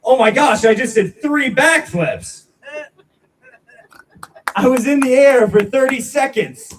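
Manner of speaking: loud, high-pitched hammed-up boast; mock amazed shouting. Comic faking a stunt for online viewers, mock amazed shout. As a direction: shout it like you pulled off a faked stunt. Pretends he did backflips unseen by viewers.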